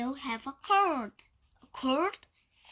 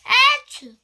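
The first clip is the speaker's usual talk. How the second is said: high loud achoo; loud sneeze; 10 semitones higher, 12 dB louder than usual. Acted. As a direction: release the sneeze. Sneeze after build-up.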